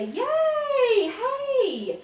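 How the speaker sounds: very high, happy squeal; excited cheer. Real, not acted. Host cheers a viewer from Fayetteville, excited squeal. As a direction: cheer for them.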